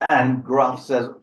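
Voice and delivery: ordinary delivery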